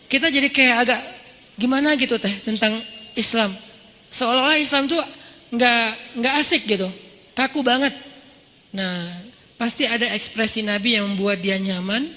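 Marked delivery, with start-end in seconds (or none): none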